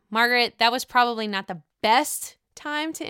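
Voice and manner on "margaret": ordinary delivery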